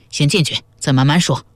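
ordinary delivery, nothing marked

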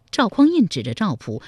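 plain reading voice

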